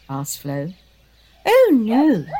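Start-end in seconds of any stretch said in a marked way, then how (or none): 1.5-2.3 grinning Diva. Acted. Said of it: "with a toothy grin".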